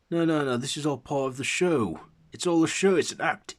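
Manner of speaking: loud, high protest; dismissive bystander. A narrator's reading. Standing man insisting to Akiten it's a show, loud protest. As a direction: insist loudly, pitched high.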